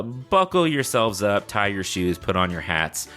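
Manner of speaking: usual speaking voice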